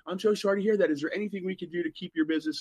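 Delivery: lightly voiced fake sorry bank clerk.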